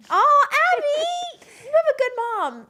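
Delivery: high, slow, tender coo; tender gushing. Real, not acted. Friend coos to Abby about her good mom, high and tender. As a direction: coo it like melting over something sweet.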